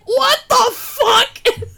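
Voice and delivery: slow, high, loud disgusted shout; horrified shout. Retold or imitated. Retelling the daughter's disgust, a slow laughing shout. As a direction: drag out the horror, laughing.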